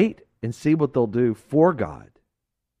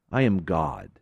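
lower, solemn divine voice; voice of God; 8 semitones lower, 3 dB quieter than usual. Acted. God rebukes Lucifer for turning the angels, low and solemn. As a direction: speak down from the throne.

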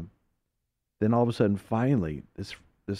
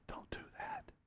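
very soft whisper; sweet whisper; 25 dB quieter than usual. Acted. Holy Spirit giving a first gentle warning, whispering. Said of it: "sweet whisper of the Holy Spirit".

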